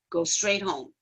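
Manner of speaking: a hint of a cold, stern vice principal; stone-cold principal. Vice principal dismissing the student, lightly voiced, low and cold. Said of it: "he looks at me stone cold".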